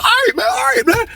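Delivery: high strained surrender; imitating the beaten man. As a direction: whimper that you give up. Victim gives up.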